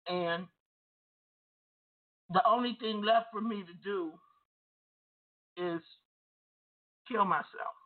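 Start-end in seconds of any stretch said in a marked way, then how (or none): none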